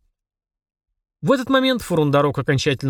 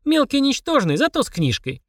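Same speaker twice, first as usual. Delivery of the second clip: put-on high, piping child voice; child's retort; 12 semitones higher than usual. A narrator's reading. Child answering the demon's insult, high cheeky retort.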